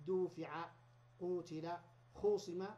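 دوفع قوتل خوصم with long u, dufi'a, qutil, khusim; passive pronunciation. Shown as a demonstration. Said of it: "نقلب الألف واو".

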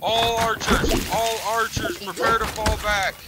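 high, drawn-out battle commands; shouting orders over battle. Real, not acted. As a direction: call the archers like a general.

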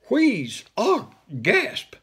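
high breathless wheeze and gasp; wheezing gasp. Acted. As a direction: gasp for air in panic. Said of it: "wheeze ... gasp".

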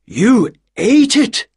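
loud, slow, high disbelief; shocked question. Acted. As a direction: cry out in shock at the news. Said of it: "he stood up excitedly".

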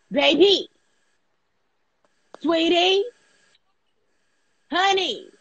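mock sweet. Real, not acted. Mock sweet names to viewer.

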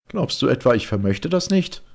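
höher und lauter, überlegen spottend; spöttischer Ich-Erzähler. Acted. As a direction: den Gefangenen mit ironischer Höflichkeit necken. Erzähler droht dem Mönch. (Erzähler verspottet den gefesselten Mönch lauter und höher)

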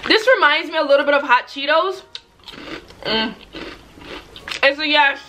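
reacting to spicy heat; talking while chewing. Real, not acted. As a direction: mouth partly full of snack. Seen 1: Holds snack piece, chews with closed lips, then grimaces from the heat.

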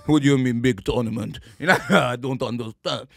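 put-on lightly done Austrian Arnold accent; a hint of Arnie's Austrian accent, half laughing; mild Austrian Schwarzenegger impression. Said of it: "with his Arnold Schwarzenegger accent".

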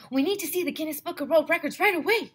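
fast, high, loud rush of words; urgent and breathless. A narrator's reading. Boy bursting into the library, breathless and urgent. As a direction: blurt it out like you ran all the way. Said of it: "I was out of breath".

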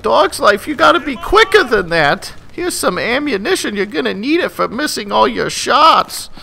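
high-pitched taunting teammate voice; mocking teammate. Teammate ribs player for missed shots, high mocking voice. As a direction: needle him in a put-on high voice. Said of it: "He's like".

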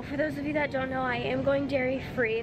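ordinary delivery

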